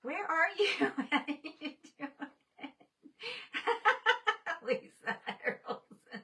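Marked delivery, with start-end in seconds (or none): 3.0-4.6 a sudden, high giggling burst; high laugh. Real, not acted. Reacting to a viewer's comment, high giggle.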